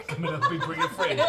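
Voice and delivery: high, giggling cat voice imitation; laughing imitation. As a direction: squeak it out through laughter. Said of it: "says the female cat".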